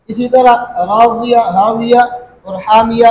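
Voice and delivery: راضیہ ہاویہ حامیہ with the final vowel leaned toward e; teacher demonstrates imala on راضیہ ہاویہ حامیہ; imala demo. Shown as a demonstration. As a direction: model راضیہ, ہاویہ, حامیہ clearly, endings tilted to e. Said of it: "اس میں امالہ کیا".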